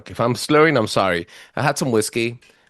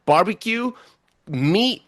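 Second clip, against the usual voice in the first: excited listing; 8 semitones higher, 3 dB louder, slower than usual. Real, not acted. Excited list, Bigfoot.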